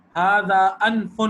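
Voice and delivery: ھذا انف drawn out very slowly and loudly; teacher's model reading; slow teaching read. Shown as a demonstration. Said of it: "ایک ہی ہوتا ہے".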